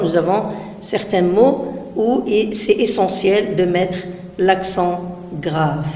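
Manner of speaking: ordinary talk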